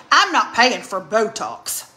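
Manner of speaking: lightly voiced mother, slow and firm; a hint of mom laying down the law; mother's refusal. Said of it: "that sounds just like her".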